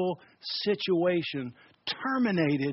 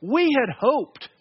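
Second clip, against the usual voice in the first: "We had hoped" stretched out, higher and slow; slow, disappointed; 6 semitones higher, 3 dB louder, slower than usual. Retold or imitated. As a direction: linger sadly on "We had hoped". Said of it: "they have this great phrase".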